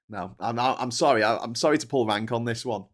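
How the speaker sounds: ordinary talk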